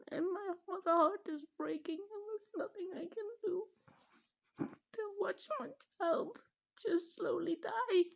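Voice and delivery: high, tearful, voice cracking; heartbroken, fighting the tears; crying. Real, not acted. Mother describing watching her child die, crying.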